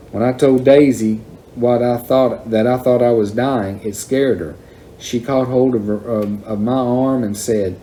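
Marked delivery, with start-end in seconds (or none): none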